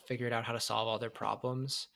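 normal talking voice

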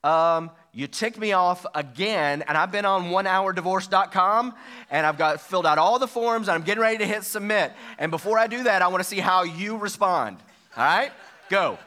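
angry harsh tone. Shown as a demonstration. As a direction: confront like you're ready to divorce. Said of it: "Or you could do it like this".

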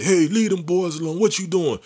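high, loud call across the street; an older man yelling from his car. Retold or imitated.

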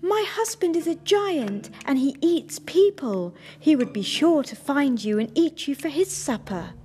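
frightened warning. A narrator's reading. Giant's wife warning Jack about her husband, high and loud. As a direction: warn him urgently, afraid for him. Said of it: "cried the woman".

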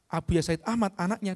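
usual speaking voice

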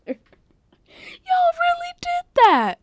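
very high, drawn-out squeal; slow, squeaky wail of disbelief; tearful hilarity. Real, not acted. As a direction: squeal it through helpless laughter. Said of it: "I have tears in my eyes".

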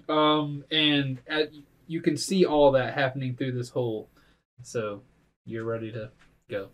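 normal talking voice

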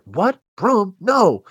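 high, loud, escalating disbelief; three separate outbursts of imitated shock; mock alarm. Imagining Hellboy learning Broom will die, mock alarm.

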